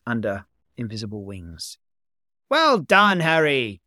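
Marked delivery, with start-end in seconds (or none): 2.5-3.8 loud, high praise; exclaimed at full voice; cried out. A narrator's reading. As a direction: shout it up to the boy in the air. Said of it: "Gomez cried".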